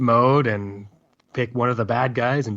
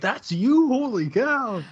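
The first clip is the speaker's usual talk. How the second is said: much higher, astonished voice; amazed coworkers; 12 semitones higher, much slower than usual. Retold or imitated. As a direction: marvel at hearing it was him all along. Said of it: "and they're like".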